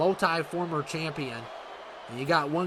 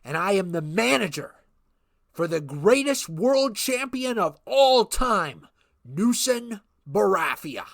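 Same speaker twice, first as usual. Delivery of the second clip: wrestling manager promo; 3 semitones higher than usual. Acted. Manager Ken Chrysler hyping the champion to the arena, showman promo. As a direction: hype the crowd like a ring manager.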